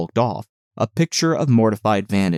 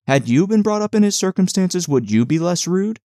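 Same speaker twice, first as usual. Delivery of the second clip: higher-pitched, mildly indignant challenge; reproachful question; 8 semitones higher than usual. A narrator's reading. Nurse scolding Catherine for mocking her cousin, reproachful and raised in pitch. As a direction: put it to her as a pointed reproach.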